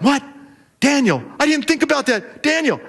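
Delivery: a king caught in his own law, flustered; king panicking to Daniel over the bad law; panicked king. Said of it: "he is panicked".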